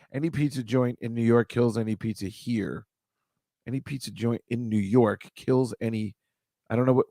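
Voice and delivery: ordinary talk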